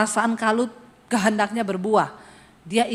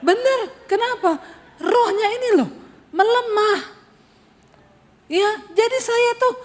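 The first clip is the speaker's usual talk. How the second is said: much higher, laughing at herself; 10 semitones higher than usual. Real, not acted. Preacher laughing about her weak spirit, high-pitched. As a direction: giggle through the confession.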